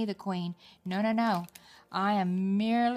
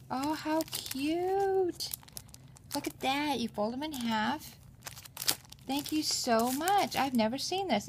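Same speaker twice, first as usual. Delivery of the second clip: cooing delight; 6 semitones higher than usual. Real, not acted. Crafter cooing to viewers over tiny folders, high and charmed. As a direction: gush like it's adorable.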